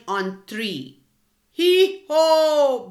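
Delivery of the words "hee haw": loud high hee-haw bray; donkey bray. A narrator's reading. Donkey starting the concert, loud bray. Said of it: "brayed the donkey".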